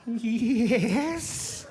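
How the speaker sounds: mock Scottish granny yes; Mrs Doubtfire voice. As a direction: chirp yes like an old Scottish lady. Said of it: "sounds like Missus Doubtfire".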